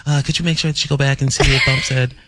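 lightly voiced imitation of her asking the back room; a playful hint of the co-host's voice; mock co-host. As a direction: teasingly mimic her request. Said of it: "That's not how I said it".

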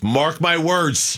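slow, drawn-out mock proclamation; mocking dramatic. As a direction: spell out each word like a prophecy. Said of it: "Write this day down".

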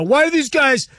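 laughing mock outrage; mock indignant. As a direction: act out a puzzled online commenter. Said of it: "in the social media sphere go".

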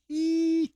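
high-pitched squealed giggle; high laugh. Real, not acted. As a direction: giggle way up high at your own joke. Laughing at his own crude joke.

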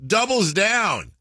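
high, slow, drawn-out announcer call; home run call. Pretend. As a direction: belt it like a stadium announcer. Mimicking the ballpark home run calls.

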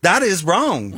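Southern drawl encore, said for fun; Southern accent. Shown as a demonstration. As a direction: redo the line with a Southern twang on purpose. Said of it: "hit me again".

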